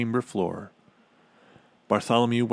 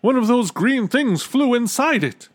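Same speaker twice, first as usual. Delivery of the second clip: very high, loud panicky voice; high-pitched loud alarm; 14 semitones higher, 7 dB louder than usual. A narrator's reading. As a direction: squeal it out in dismay. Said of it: "he gulped".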